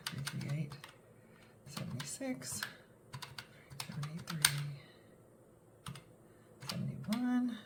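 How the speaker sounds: very soft, to oneself; absent muttered counting. Real, not acted. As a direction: murmur figures while tallying, long gaps. Adding up debts on a calculator.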